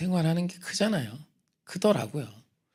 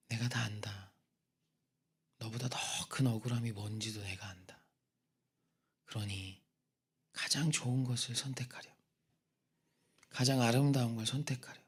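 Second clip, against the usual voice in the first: tender voice of Jesus; 3 semitones lower, 7 dB quieter than usual. Retold or imitated. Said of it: "십자가 달리신 모습을 이렇게 보여주시는데".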